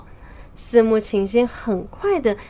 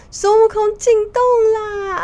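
loud alarm shout; 12 semitones higher, 7 dB louder than usual. Acted. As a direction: holler a warning to the whole cave. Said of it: "大声地喊道". (高声喊叫报警，尾音拖长)

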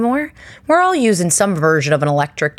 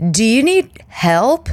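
higher, slow, sweet offer; sweet hygienist; 6 semitones higher, slower than usual. Retold or imitated. Hygienist asking the struggling mother, higher and slow. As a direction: play a concerned hygienist lightly.

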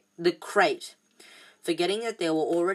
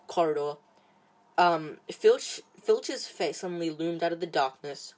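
usual speaking voice

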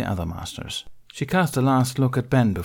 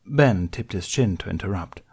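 ordinary delivery

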